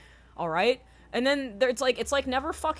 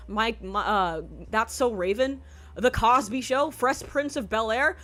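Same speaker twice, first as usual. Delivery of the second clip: normal talking voice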